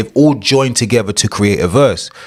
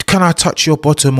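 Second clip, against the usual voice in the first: lightly exaggerated British accent, pitched up; 7 semitones higher than usual. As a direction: imitate a polite British chat-up in a higher voice. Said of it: "if you come with a British accent".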